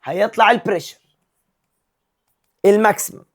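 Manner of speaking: normal talking voice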